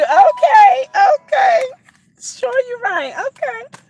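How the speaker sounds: high, loud excited squealing. Real, not acted. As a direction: squeal it with glee.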